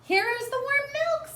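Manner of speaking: very high, sweet little-sister voice; eager Baby Lincoln. Acted. Baby Lincoln bringing milk to the piglet, very high and eager. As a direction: proudly present the bottle.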